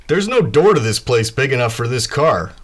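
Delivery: Kai. Acted. Kai to Warble about the parked car, loudly puzzled. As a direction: point out the oddity with loud amazement. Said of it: "He's like".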